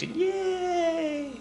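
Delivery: high, squealed yay, hammed-up; mock cheer. Teacher mocking the Camelot fairy tale with a squealed cheer.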